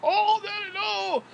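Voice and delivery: very high, drawn-out background wail; mock scream. Screaming away from the phone to fool callers, high mock scream. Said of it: "I'd hold the phone away from me and go".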